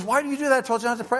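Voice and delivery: high-pitched mock protest; half-yelled complaint, acted out high; retold screaming. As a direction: imitate her worked-up outburst. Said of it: "she starts screaming again".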